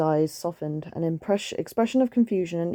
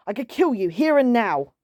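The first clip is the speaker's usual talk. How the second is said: menacing tiger; 5 semitones higher, 4 dB louder than usual. Acted. Tiger threatens the cat.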